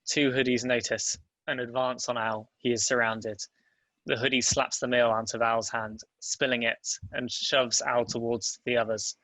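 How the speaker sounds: ordinary talk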